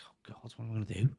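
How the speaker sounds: fast unvoiced whisper of panic; a rushed, breathy whispered worry; panicked whisper. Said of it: "I was like".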